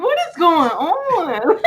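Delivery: high, slow, baffled question; bewildered. Retold or imitated. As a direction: drag it out, totally lost.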